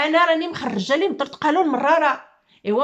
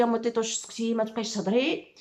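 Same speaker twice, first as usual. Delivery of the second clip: quiet imitated plea; 4 semitones lower, 7 dB quieter than usual. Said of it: "قلتوا لي".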